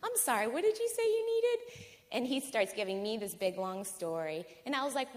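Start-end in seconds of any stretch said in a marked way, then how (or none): 0.0-1.9 much higher, feigned puzzlement; mock-polite playing dumb. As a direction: act like you didn't quite hear him, pitched way up.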